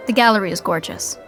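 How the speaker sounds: ordinary delivery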